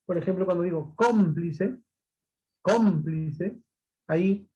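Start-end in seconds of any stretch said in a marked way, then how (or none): 1.0-1.8 cómplice with the first syllable leaned on; stress on first syllable. Shown as a demonstration. Teacher showing where the accent falls, stress on CÓM in cómplice. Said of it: "la mayor fuerza de voz". 2.6-3.6 cómplice, weight on the opening syllable; stress on first syllable. Shown as a demonstration. Teacher repeating the example word, stress on CÓM in cómplice. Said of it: "En la sílaba com".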